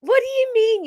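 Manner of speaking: very high, loud fake sweetness; hammed-up bundle of joy; pretend joyful voice. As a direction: squeal it with badly faked cheer. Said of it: "I can't even pretend to be".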